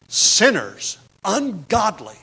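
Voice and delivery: Sinners and ungodly as two separate punches; word-order emphasis. Shown as a demonstration. Reading Jude's Greek word order aloud, each word punched. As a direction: set "Sinners" apart, then drop "ungodly" on its own. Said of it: "literal translation that preserves the Greek word order".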